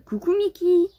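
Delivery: voix très aiguë et forte, pour l'animal. Real, not acted. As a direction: saluer Mickey d'une voix haut perchée. (voix de gâtifiage aiguë)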